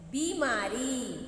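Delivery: बीमारी drawn out slowly with a long "ई"; teacher modelling बीमारी for children; slow model word. Shown as a demonstration. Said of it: "हम क्या बोलते हैं?".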